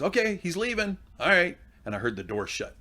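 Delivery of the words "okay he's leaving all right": imitated daughter, pitched well up; daughter calling out. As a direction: announce it to dad in a high girl's call. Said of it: "Finally, she goes".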